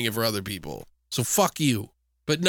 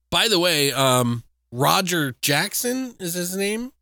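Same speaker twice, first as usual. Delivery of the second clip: ordinary talk, nothing marked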